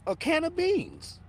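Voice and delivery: high, slow disbelief; incredulous. Retold or imitated. Retelling the landlord's slur, high and incredulous.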